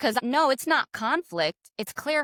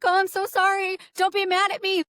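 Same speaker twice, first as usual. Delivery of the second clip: high, louder pleading of a flustered clerk; panicked clerk; 10 semitones higher, 4 dB louder than usual. Retold or imitated. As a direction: squeak it out like a scared clerk begging forgiveness. Imitating the panicked lottery clerk.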